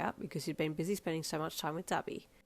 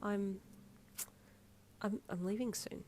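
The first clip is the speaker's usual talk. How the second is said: quivering voice; 3 semitones higher, 9 dB quieter than usual. Acted. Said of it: "with a quiver in your voice".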